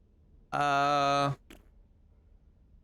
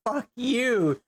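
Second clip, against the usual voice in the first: louder, higher angry yell; angry shout; 8 semitones higher, 4 dB louder than usual. Real, not acted. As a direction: yell it at the screen. Raging at the game.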